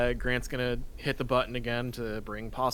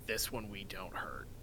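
quiet warning; 4 semitones lower, 11 dB quieter than usual. Acted. Grant warns about the dog.

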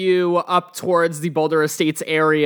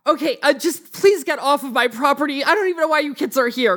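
higher-pitched, flustered homeowner; impatient woman's voice, pitched up; exasperated woman homeowner; 8 semitones higher than usual. Acted. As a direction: shoo the kids away in a raised pitch. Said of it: "she's like".

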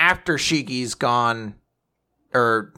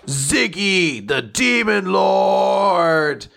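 dramatic reveal; 6 semitones higher, much slower than usual. A narrator's reading.

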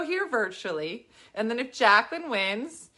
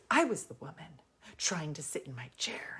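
hushed whisper; 6 semitones lower, 10 dB quieter than usual. Retold or imitated. Heckler whispering her complaint to the comedian during the show. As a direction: whisper it, barely voiced.

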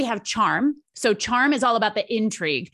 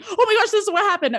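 excited telling; 10 semitones higher, 4 dB louder, faster than usual. Retold or imitated. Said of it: "if somebody comes to you and they say".

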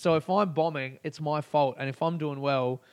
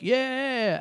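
crowd cheer; 9 semitones higher than usual. Retold or imitated.

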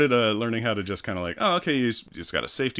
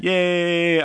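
excited cheer; 9 semitones higher, 6 dB louder than usual. Real, not acted. Cheering for beer.

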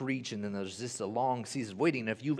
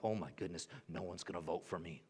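quiet worried self-talk, much softer; anxious inner voice; 8 semitones lower, 11 dB quieter, faster than usual. Retold or imitated. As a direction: murmur your fears to yourself, lower. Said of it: "I'm like".

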